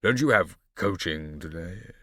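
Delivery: bored father. A narrator's reading.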